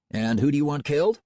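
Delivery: smooth cold-calling salesman; confident, warmly professional pitch. Acted.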